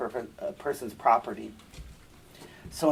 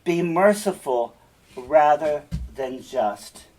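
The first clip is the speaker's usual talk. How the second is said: louder, drawn-out plea for mercy; pleading father; 6 dB louder, slower than usual. Acted. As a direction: beg her, stretching out rather than just. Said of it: "he answered".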